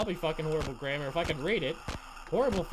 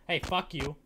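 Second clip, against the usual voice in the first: ordinary talk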